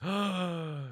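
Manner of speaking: soft drawn-in gasp; shocked gasp. Acted. Game master reacting as a player freezes, soft gasp. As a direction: gasp softly in acted horror.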